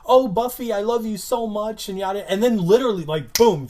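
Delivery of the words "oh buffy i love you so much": mock sweet sister. Mock sweet Dawn before outburst.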